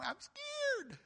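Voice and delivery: put-on very high, drawn-out child's voice; scared little kid. Little kid answering "what's wrong", hammed-up scared voice. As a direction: squeak it like a frightened child. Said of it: "That's what the little kid said".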